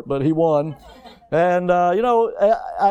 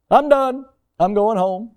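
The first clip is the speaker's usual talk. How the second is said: higher and louder, fed up and quitting; mock quitter; 6 semitones higher, 3 dB louder than usual. Pastor mimicking himself quitting golf after hole one, fed up. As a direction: throw up your hands and stomp off.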